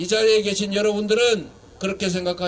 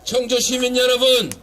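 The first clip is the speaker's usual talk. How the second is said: rousing shout; 3 semitones higher than usual. Real, not acted. Politician rallying Cheongju citizens behind a candidate, shouting. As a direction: shout out a promise to fire up the crowd. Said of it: "이제 소리 지르는 건 그만하고".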